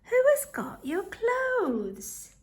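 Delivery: slow, high hushed little rabbit voice; a small rabbit asking secretly, drawn out; little Benjamin's whisper. Acted. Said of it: "said little Benjamin in a whisper".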